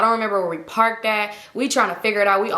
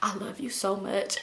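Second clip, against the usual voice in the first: much softer, slow and tender; soft drunk confession; 11 dB quieter, slower than usual. Retold or imitated. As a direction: breathe it out softly. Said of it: "I'm like".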